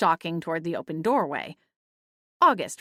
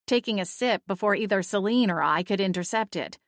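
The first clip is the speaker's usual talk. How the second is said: ordinary delivery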